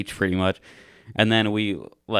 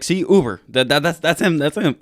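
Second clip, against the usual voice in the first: high, loud, stammering insistence; frantically prove it to the cop; retold excited relief; urgent pointing out; 7 semitones higher, 6 dB louder than usual.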